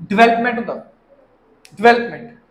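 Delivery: डेवलपमेंट as "di-VEL-up-ment"; correct development pronunciation. Shown as a demonstration. Teacher correcting students, डेवलपमेंट as "di-VEL-up-ment". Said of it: "बोल दिंदे".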